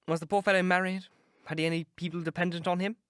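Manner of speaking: a hint of a nervous young gentleman, quick; Dorian's worry slips through quickly; lightly voiced Dorian, uneasy. Dorian asks the keeper about the accident, a touch uneasy.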